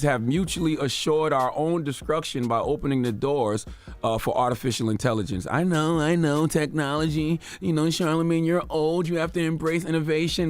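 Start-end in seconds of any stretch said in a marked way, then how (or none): 5.5-10.5 higher, sing-along monotone of a dismissive objector; mock critic. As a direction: parrot the naysayers in a raised, even pitch. Voicing his critics' objection to him.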